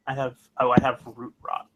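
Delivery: ordinary talk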